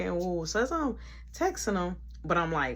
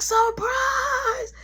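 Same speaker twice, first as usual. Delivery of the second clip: high excited yell; surprise shout; 4 dB louder than usual. Retold or imitated. Party crew springing a surprise on the birthday girl, yelling. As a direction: burst out from hiding, thrilled. Hidden coworkers jump out.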